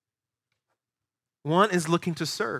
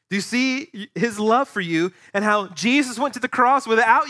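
higher, louder impassioned preaching; preaching at full force; 6 semitones higher, 4 dB louder than usual. Acted. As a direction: build the fervour toward the cross. Climactic preaching.

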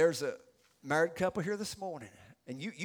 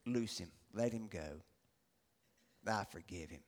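much lower and softer, quiet mercy; compassionate; 12 semitones lower, 11 dB quieter than usual. Acted. King releasing his debtor, quiet and compassionate. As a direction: drop to a hushed, low pardon. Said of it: "the old king had compassion".

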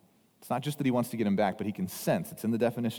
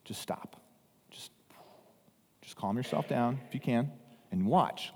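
calming hush; 4 dB quieter than usual. Shown as a demonstration. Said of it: "translate the word silent is still".

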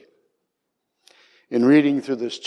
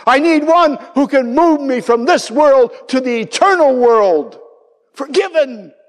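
impassioned shout; 13 semitones higher, 4 dB louder than usual. Retold or imitated. Dying man realizing he needs a savior, shouting. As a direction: shout it like a man begging for rescue.

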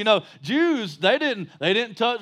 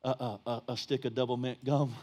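sheepish Peter aside; 10 semitones lower, 8 dB quieter than usual. Retold or imitated. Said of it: "He's like".

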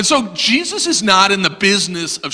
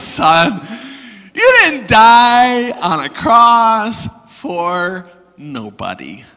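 solemn fatherly correction; 4 semitones higher, 4 dB louder, much slower than usual. Retold or imitated. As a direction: spell it out weightily, like dad. Dad solemnly corrects his son.

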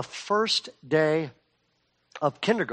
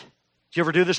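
normal talking voice

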